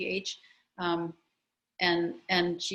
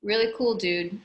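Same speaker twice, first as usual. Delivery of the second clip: ordinary talk, nothing marked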